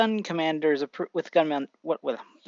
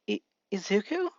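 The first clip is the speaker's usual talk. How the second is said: mild tearful disbelief, higher and softer; starting to tear up; 4 semitones higher, 5 dB quieter than usual. A narrator's reading. Mother seeing her son at the door, mildly tearful question. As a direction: falter into a soft, rising question. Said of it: "as she starts tearing up saying".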